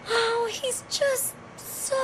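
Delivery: breathy swoon into a startled gasp; flustered gasp. Acted. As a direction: catch yourself mid-swoon. Catches herself swooning.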